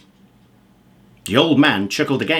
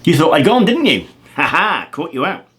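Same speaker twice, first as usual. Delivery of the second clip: louder, cheeky wizard gloating; gleeful wizard taunt; 3 semitones higher, 6 dB louder than usual. Acted. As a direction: catch him out with a laugh. Wizard mocking after vanishing.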